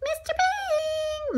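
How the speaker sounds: high, stretched-out name call; sing-song calling out. Acted. Arnie calls to his new owner, high drawn-out call. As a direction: coo the name high and long.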